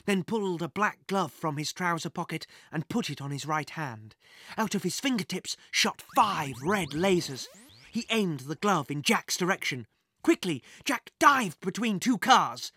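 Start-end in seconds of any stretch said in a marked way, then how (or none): none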